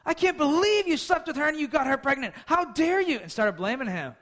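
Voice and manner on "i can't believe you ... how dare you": high, loud mock outburst at her husband; jealous anger. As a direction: rage at him like a jealous wife. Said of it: "goes to Abraham and says".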